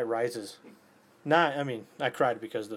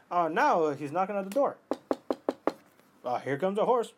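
higher, hammed-up radio-play exclamation; old radio-drama actor; 6 semitones higher, 4 dB louder than usual. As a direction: announce each event like an old radio serial. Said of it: "was like a radio drama".

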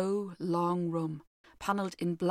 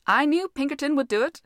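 higher, louder gloating exclaim; triumphant; 6 semitones higher, 6 dB louder than usual. A narrator's reading. Washington showing off to his admiring family, triumphant. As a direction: crow over the clean floor. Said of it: "he exclaimed triumphantly".

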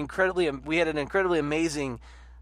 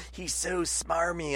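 slow, much softer sneer, a different put-on voice; sneering mock; 9 dB quieter, slower than usual. Imitating critics who call Obama smarmy, slow sneering put-on voice. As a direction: drawl it out with contempt.

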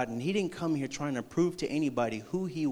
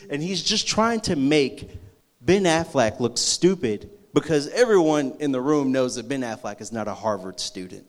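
ordinary delivery

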